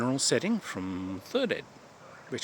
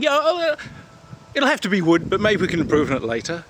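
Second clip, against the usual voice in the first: put-on much higher and louder eager kid voice; naive young gamer; 7 semitones higher, 5 dB louder than usual. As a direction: agree brightly like a gullible young player. Imitating young Derek agreeing.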